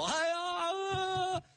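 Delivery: high wailing cries; hammed-up widower bursting into sobs; loud high cry of grief, played for the story; mock loud wailing. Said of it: "放声痛哭".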